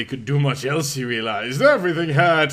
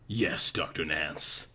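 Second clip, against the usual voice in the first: low, slow and soft, sultry; sexy nurse voice; 10 semitones lower, 7 dB quieter than usual. A narrator's reading. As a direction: purr it like a seductive nurse. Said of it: "said the sexy voice".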